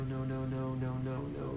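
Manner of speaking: ordinary talk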